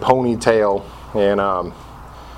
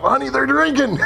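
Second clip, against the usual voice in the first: higher, mock-shocked telling; breathless scandal to your wife, pitched up; scandalized report; 12 semitones higher than usual. Husband tells wife AA members drink, higher mock shock. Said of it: "told my wife".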